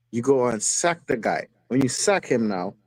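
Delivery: ordinary delivery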